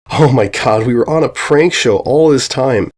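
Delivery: usual speaking voice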